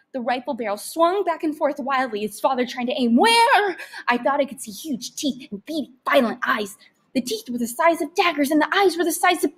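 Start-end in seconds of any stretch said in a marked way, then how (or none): 3.1-3.8 high frantic question; frantic where. A narrator's reading. Father hunting the rat with his rifle. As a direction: search wildly, voice jumping up.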